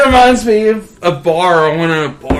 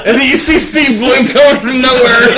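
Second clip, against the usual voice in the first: ordinary delivery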